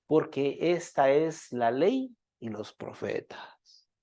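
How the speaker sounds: soft, slowed reading of the Bible verse; scripture reading. Acted. Preacher reads scripture to the congregation, soft and slow. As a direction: read the passage gently, lowering the voice.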